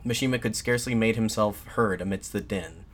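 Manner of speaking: usual speaking voice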